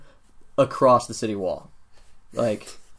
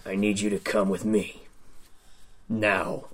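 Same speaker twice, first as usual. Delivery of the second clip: gruff hobgoblin voice; 3 semitones lower, 7 dB quieter than usual. Acted. Hobgoblin orders player to follow.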